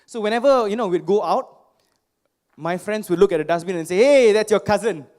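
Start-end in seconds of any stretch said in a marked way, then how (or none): 4.0-5.1 loud jeering schoolboy taunt, louder; mocking tease. Friends jeer at him beside a dustbin, loud mocking taunt. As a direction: shout it out like a kid mocking a friend.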